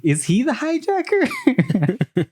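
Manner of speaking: high, slow, laughing disbelief; incredulous question. Retold or imitated. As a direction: ask it as if it were absurd, laughing. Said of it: "she was like".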